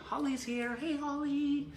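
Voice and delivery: high, singsong hello; calling out a greeting. Real, not acted. Host spots Holly joining, calls out a high hello.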